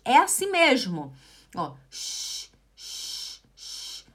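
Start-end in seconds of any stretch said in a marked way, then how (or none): 1.9-4.0 three soft hushing shushes; shushing. Shown as a demonstration. Teacher pretending to ask for silence, soft shushes. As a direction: shush someone repeatedly. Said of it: "pedindo pra alguém fazer silêncio".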